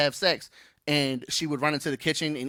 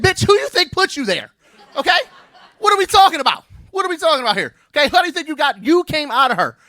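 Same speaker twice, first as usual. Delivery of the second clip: much higher, louder shouting rant; mock outraged yell; 11 semitones higher, 6 dB louder than usual. Dad yells at son about mom, mock outraged. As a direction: blow up at the kid in mock fury.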